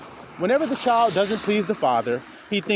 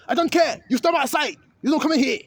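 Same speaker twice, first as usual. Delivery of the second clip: a shopkeeper turning a starving man away; cold angry shout; 9 semitones higher than usual. Acted. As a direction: bark him back out the door. Store owner refuses thief.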